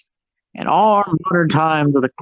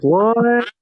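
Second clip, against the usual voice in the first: mock surprise; 6 semitones higher than usual. Guy reacts.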